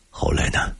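soft, tentative prompt, nearly whispered; tentative question. A narrator's reading. Listener breaking the silence to prompt the story, tentative. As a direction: ask gently, testing the silence. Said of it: "我用试探的口吻".

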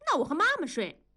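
put-on high little-kid voice; child's voice. A narrator's reading. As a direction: pipe up like a small child. (小孩子的高音)

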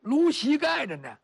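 usual speaking voice

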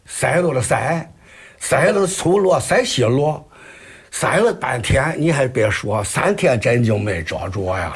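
storyteller's Shandong chant in Jinan dialect, winding down to the punchline; 济南话快书收尾; Jinan dialect Shandong kuaishu. Acted. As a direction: deliver the tale's ending in Jinan dialect. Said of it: "您这个济南话 很标准呀".

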